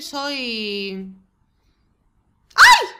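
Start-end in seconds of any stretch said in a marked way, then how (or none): 2.6-2.9 sudden high squeal of delight; excited shriek. Real, not acted. A squeal at the Sims becoming a couple. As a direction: shriek with joy. Reacting to the Sims' new couple.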